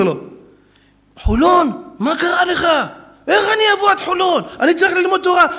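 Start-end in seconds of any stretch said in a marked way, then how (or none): 1.2-5.5 high, loud protest; refuse, outraged at the trip; loud indignant old sage; indignant rabbi. Retold or imitated.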